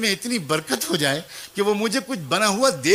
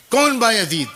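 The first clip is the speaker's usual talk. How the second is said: a touch louder stern mother asking who; mother's voice; 4 semitones higher, 6 dB louder than usual. Acted. Mother behind the door challenging her son, sharp. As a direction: call back sharply through the door, a bit louder.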